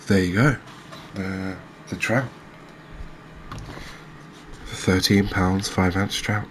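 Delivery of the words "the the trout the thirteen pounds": usual speaking voice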